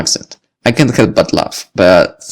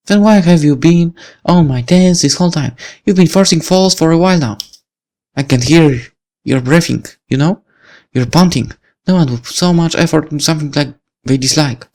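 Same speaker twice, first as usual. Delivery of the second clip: put-on higher, suspicious young woman; accusing Lucy; 8 semitones higher than usual. Lucy confronting the boy from her apartment, suspicious. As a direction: accuse him of stalking. Lucy challenges his effort.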